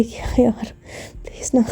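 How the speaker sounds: breathy pleading whisper, please; hushed wheedling please. Acted. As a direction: beg her softly under the breath.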